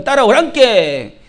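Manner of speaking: slow, stretched Jeolla dialect call. Acted. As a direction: call out to the flock in Jeolla accent. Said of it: "목자가 가면요". (길게 뽑는 전라도 사투리)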